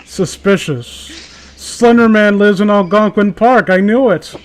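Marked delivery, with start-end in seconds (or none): 1.6-4.3 mock conspiracy reveal. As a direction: announce it like a big secret.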